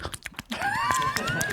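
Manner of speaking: high laughing mock scream. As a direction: squeal like a madman, laughing. Imitating guy with knife.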